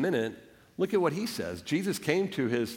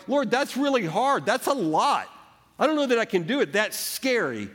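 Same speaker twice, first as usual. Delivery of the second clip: anxious plea to the Lord; 8 semitones higher than usual. Retold or imitated. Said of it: "And you're like".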